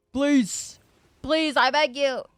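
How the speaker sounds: high, loud pleading whine; hammed-up begging, voice cracking high. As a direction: beg the game desperately. Begging the game for good roll.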